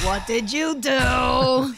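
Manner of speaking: slow, loud drawn-out what did you do; mock alarm. Real, not acted. Cohost teasing him about his tweet, slow and loud.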